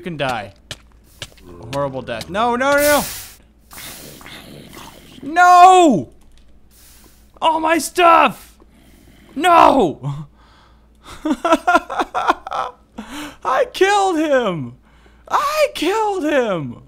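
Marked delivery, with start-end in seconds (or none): 2.3-10.3 loud, high wailing no; a long slow wail on all my stuff; despairing scream. Real, not acted. Dies and loses items. 11.0-12.7 shrill disbelieving cackle; a high helpless laugh; hysterical high laugh. Real, not acted. Disbelief after dying. 13.4-16.8 high, slow wailing cry; stretched high lament; wailing disbelief. Real, not acted. Boss died as she died.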